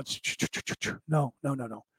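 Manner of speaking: very soft shush and hushed no; quiet it down like a fretting child; whispered shh, then soft no no no; shushing the ego. Pretend. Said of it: "And I go".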